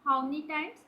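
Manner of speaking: ordinary talk, nothing marked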